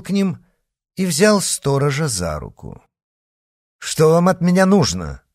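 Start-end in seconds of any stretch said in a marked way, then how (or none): 3.8-5.2 higher-pitched, curt demand; brusque, irritated challenge; sharp question. A narrator's reading. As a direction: ask it sharply, voice pushed up. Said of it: "резко спросил герцог".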